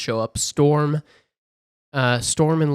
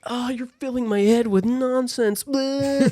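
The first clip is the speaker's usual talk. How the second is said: much higher, hammed-up dismissive voice; mock Jean; 12 semitones higher than usual. Jean mocking Charles's warning in a silly high voice. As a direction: brush them off in a silly high voice. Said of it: "she's like".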